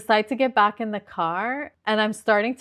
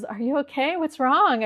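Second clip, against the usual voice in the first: higher-pitched worried check-in; raised, lifting questions of concern; concerned questioning; 6 semitones higher, 4 dB louder than usual. Retold or imitated. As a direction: imitate a concerned salesman asking after someone upset. Salesman sees her crying, asks.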